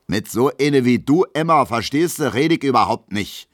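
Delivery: loud, high-pitched scornful dismissal; contemptuous. A narrator's reading. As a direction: brush her off with open contempt. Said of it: "meint Gustav Schmelow verächtlich". (laut und verächtlich)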